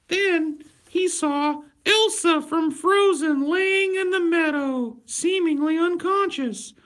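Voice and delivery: very high, loud sing-song fairytale voice; cutesy high storyteller parody; mock storybook voice. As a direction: read it like a sweet children's tale. Telling fairytale in childlike voice.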